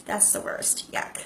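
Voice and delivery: whispered yuck, quiet and dry; disgusted aside. Real, not acted. Vlogger reacting to backhanded apologies, whispered disgust. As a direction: mutter it under your breath in distaste. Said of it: "reacting to smart remarks".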